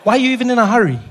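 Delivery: loud, high-pitched comic scolding; indignant rant. Acted. Citizen berating a minister in a hurry, indignant rant. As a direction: berate them in mock outrage.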